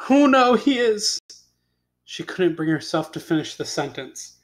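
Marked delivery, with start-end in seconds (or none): 0.1-1.2 high, distressed cry breaking off. Acted. Beauty finds the beast on the ground, distressed. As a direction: cry out in alarm and stop short. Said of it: "Beauty cried".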